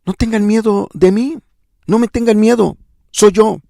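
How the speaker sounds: reach out to calm them; Jesus reassuring frightened disciples, tenderly. Acted. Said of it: "lo que les está diciendo es".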